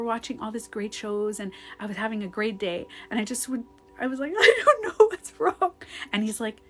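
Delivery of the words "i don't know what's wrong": high, loud mock wail; mock crying. Crying girlfriend answering a worried boyfriend, mock crying. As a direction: imitate a tearful, helpless outburst. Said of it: "'cause I was just crying".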